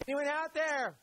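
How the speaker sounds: high, pleading call from inside a tomb; hammed-up trapped man asking to be let out; impatient Jesus knocking. Preacher voicing Jesus stuck inside the tomb, mock impatient. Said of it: "Jesus wasn't on the inside going".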